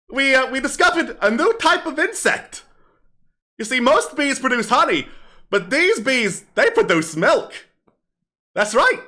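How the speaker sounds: bawdy kobold comic on stage; kobold comedian. Acted. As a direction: perform it like a street comic working a crowd. Game master plays kobold street comic.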